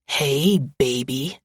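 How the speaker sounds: low, menacing croon; low predatory croon to his ex. A narrator's reading. Ex cornering her in an alley, low menacing croon.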